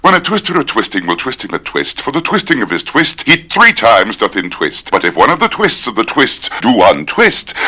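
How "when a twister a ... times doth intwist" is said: rattled off at speed; fast. Shown as a demonstration. Trying to say the tongue twister faster. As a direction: race through the twister. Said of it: "I can do that faster".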